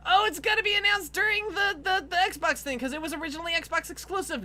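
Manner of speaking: high, eager self-parody; mock hopeful guessing. Mocking own hopes before E3, high eager voice. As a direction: voice naive hope way up high.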